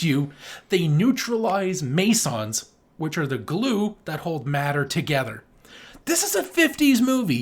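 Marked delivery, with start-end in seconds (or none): 6.1-7.4 pitched way up, louder, amazed; excited. Real, not acted. Podcast host to co-host, excited about the old movie. Marvelling at fifties film science.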